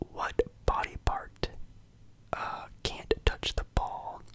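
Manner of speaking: ordinary talk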